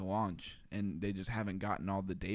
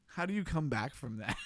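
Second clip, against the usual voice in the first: laughing; 5 semitones higher than usual. Real, not acted. Laughing at his own slip.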